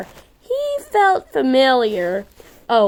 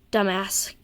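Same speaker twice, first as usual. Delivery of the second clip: under the breath, much softer; muttered insult; 3 semitones lower, 8 dB quieter than usual. Acted. Sister mutters at her brother, much softer. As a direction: mutter it to yourself with scorn.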